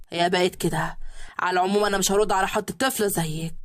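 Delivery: a cold husband brushing her off; husband's voice. A narrator's reading. (زوج بارد بصوت واطي)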